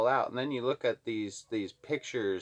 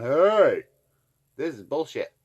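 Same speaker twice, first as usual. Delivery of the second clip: imitated outcry; 4 semitones higher, 8 dB louder than usual.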